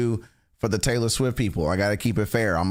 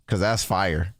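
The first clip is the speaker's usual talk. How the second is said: ordinary delivery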